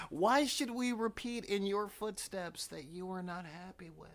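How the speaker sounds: put-on high, indignant teenage protest; exasperated teenager. Teenager challenging unhappy parents, indignant and high. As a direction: start loud and high, then ease off. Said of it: "And we were like".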